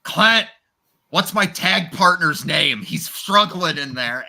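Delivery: loud urgent plea mid-match, drawn out slowly; urgent loud calling. Retold or imitated. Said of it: "He's like".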